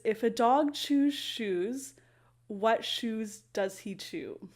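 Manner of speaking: very slow and deliberate; slow tongue twister. Shown as a demonstration. Teacher doing her third tongue twister for viewers, very slowly. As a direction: spell it out word by word. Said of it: "I was saying it quite slowly".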